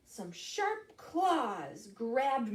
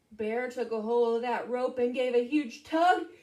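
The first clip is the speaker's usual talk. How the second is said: ordinary delivery, nothing marked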